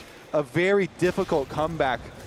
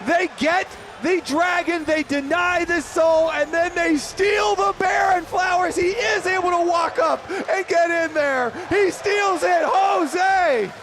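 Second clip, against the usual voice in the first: excited shout; 9 semitones higher than usual. Real, not acted. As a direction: scream the comeback with full hype. Caster erupts over comeback.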